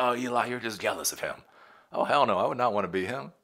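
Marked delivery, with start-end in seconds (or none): 0.0-1.4 quick, teasing put-on voice of a doubter; mocking critic. Viewer teasing the host about jealousy, quick mock voice. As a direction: toss it off like a smug commenter. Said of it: "a lot of people are like".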